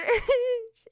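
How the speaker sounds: a high, loud burst of laughter; shrieking laugh. Real, not acted. Teacher bursting into laughter at the kid's remark. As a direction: crack up loudly.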